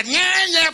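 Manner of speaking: high whiny nyah nyah; mock duckling cries. Ducklings crying for their mama, high whiny imitation. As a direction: whine like abandoned baby ducks.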